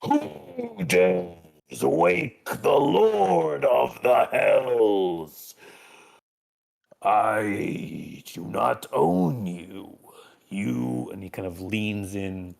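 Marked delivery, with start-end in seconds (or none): 0.0-11.1 slow, booming demon lord; Lucifer voice. Acted. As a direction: boom it out slowly like Lucifer. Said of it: "he goes".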